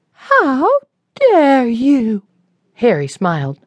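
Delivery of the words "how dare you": high, louder, offended lady; how dare you, furious; indignant outrage. A narrator's reading. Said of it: "Sarah yelled".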